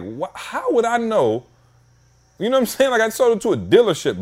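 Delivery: can't believe what they're asking. Retold or imitated. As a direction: voice jumps up in disbelief. Incredulous reply to the DMV letter.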